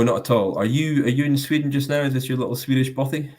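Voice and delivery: ordinary talk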